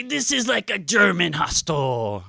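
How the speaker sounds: put-on lightly done French accent; mock French accent. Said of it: "I can do one accent".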